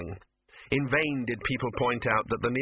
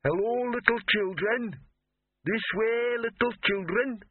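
high, slow singsong troll call; trilling troll; 11 semitones higher, slower than usual. Acted. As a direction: trill it out sweetly, high and drawn. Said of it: "A voice a little closer now trilled".

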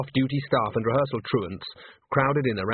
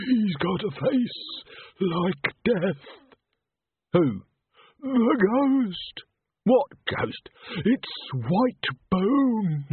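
spooky stagehand voice; 8 semitones higher, much slower than usual. Acted. Tommy telling of the ghost.

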